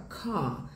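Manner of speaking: soft car as kah, no R; relaxed mouth, car without R; British English pronunciation. Shown as a demonstration. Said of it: "a boca fica mais relaxada".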